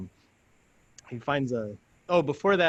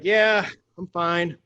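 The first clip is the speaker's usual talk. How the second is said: insisting I'm fine; 5 semitones higher, 6 dB louder, slower than usual. Retold or imitated. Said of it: "I was like".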